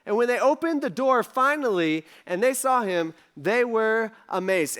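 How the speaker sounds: normal talking voice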